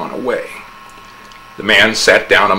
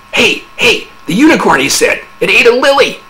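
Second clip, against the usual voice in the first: much higher, louder eager news; high-hearted excitement; 13 semitones higher, 5 dB louder than usual. A narrator's reading. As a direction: burst in thrilled. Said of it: "With a high heart".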